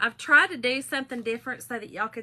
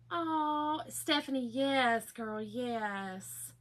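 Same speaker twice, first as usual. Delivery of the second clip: ordinary talk, nothing marked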